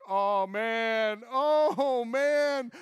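high, loud shaky oh man; shaken groan. Retold or imitated. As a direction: moan it with the shakes. Shaken after the blast.

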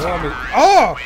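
indignant shout. Real, not acted. Shocked at dying.

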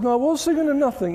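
high, mock-innocent husband; lightly voiced husband protesting innocence. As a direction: act like you were thinking of nothing at all.

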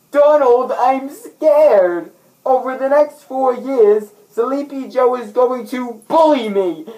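high, loud mock-frightened pleading; hammed-up scared wail. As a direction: whimper loudly to Donald like a scared child. Begging Donald, afraid of Biden.

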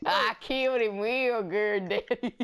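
slow, drawn-out Southern brag; Southern accent. Acted. Bragging to Daddy about a kill, Southern accent. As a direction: boast with a hammed-up Southern drawl. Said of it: "That's my southern accent".

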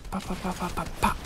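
high, rapid pop-pop noises; high playful gun-popping; mouthed gunfire. Pretend. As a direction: imitate quick shots with little pops. Voicing gunfire at the player.

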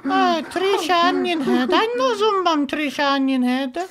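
high, quavery little granny voice; old lady voice. Acted. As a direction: coo like a sweet old grandma. Prank caller plays sweet old lady.

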